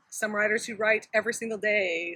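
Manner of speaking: ordinary talk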